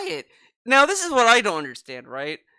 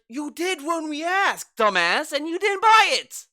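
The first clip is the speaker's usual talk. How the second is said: exasperated yell; 7 semitones higher than usual. Retold or imitated. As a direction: yell it like a fed-up fan. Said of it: "It's like".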